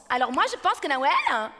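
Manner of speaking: eager brother. Retold or imitated. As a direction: rush it like a giddy little brother. Frère parle vite. (frère surexcité qui parle vite)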